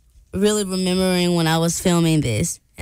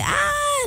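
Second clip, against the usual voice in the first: excited squeal. Retold or imitated. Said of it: "I just was like".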